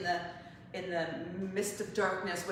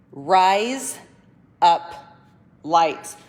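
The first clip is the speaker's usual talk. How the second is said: "Rise... up... lights", each word apart with pauses; spaced-out delivery with a gap after each word; slow, separate words; 13 dB louder, much slower than usual. Shown as a demonstration. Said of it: "if you say it fast".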